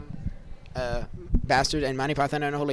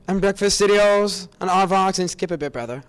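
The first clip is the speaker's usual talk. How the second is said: loud mock recitation; 3 semitones higher, 11 dB louder than usual. Fan reciting the Holy Hand Grenade scripture, booming. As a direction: proclaim it like a pompous cleric.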